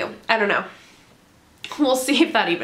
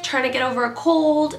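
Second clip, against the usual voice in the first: ordinary talk, nothing marked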